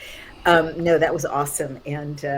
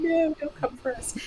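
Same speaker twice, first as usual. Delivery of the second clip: high frightened plea from hiding; scared pleading; 12 semitones higher than usual. Pretend. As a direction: beg them not to come. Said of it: "hiding under the bed going".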